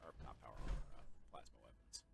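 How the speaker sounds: a soft, voiceless whisper to himself; a private whispered correction about his weapons; whispered aside. Real, not acted. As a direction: mumble the correction under your breath.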